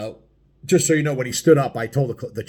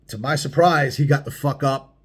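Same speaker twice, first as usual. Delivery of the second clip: ordinary talk, nothing marked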